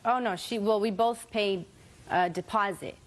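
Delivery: normal talking voice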